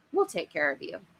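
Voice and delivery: plain reading voice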